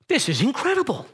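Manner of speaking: breathless, high wonder after a gasp; awestruck. Retold or imitated. Imagining being Peter on the water, awestruck. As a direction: marvel like you're walking on water.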